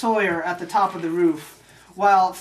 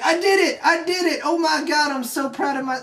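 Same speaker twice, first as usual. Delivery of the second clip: much higher, giddy imitation of triumph; mock elated cheer; 8 semitones higher than usual. Sawyer telling his mentor he made the shot, elated. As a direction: celebrate like an overjoyed rookie, hammed up.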